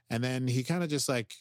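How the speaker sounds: normal talking voice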